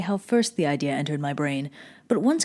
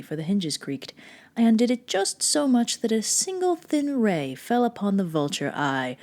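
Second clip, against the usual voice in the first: ordinary delivery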